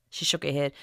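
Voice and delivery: ordinary delivery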